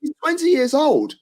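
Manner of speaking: high-pitched exasperated protest; exasperated defence. Real, not acted. Host defending a young keeper to doubters, exasperated. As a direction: argue back, voice shooting up.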